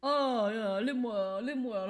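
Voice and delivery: breezy "Oh, yeah, Lim World"; light imitation of the cheerful driver; driver saying "Lim World". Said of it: "he was just like".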